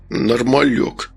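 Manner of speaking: put-on slow, slurred drunk echo; drunken grumble. A narrator's reading. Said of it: "пьяно пробурчал Эрик". (пьяное бурчание)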